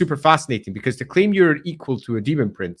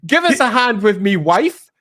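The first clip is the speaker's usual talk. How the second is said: hammed-up aggrieved husband, pitched up; Samael; 5 semitones higher than usual. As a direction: whinge to a mate about your wife. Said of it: "So Samael, one of the most powerful".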